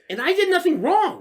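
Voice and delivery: high, loud insistence; indignant protest. Real, not acted. Storyteller telling friend he was blameless, indignant.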